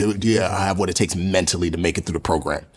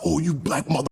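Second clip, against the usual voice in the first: higher-pitched barking drill yell; mock Goggins yell; 5 semitones higher than usual. A Navy SEAL yells at new recruits, higher-pitched mock bark. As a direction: snap at new trainees like a drill sergeant. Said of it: "He said".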